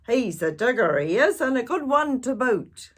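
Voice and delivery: loud, high pirate call to the crew; hearty pirate holler; pirate yell. Acted. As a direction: shout it back to the boat like a captain. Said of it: "he yelled back to a crew".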